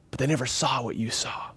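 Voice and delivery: whispered, as if sharing a secret; quiet whispered emphasis to the congregation; hushed whisper. Real, not acted. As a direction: drop to a whisper for the point. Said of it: "dramatic hushed point".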